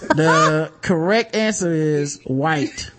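slow game-show reveal. Acted. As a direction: announce it slowly. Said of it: "slow announcer reveal".

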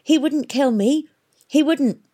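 high, disbelieving self-talk; gasped. A narrator's reading. Girl reassures herself while fleeing, gasping. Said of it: "gasped Hester, talking to herself".

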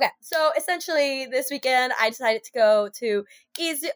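ordinary talk

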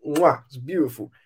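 ordinary talk, nothing marked

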